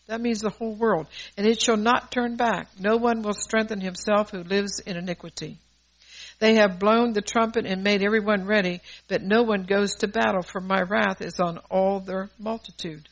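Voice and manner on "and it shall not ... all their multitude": loud, measured scripture reading; scripture declamation. Acted. Preacher reads Ezekiel seven to the congregation, loud and declaimed. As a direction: proclaim the verses forcefully, phrase by phrase.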